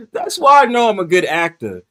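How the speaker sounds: usual speaking voice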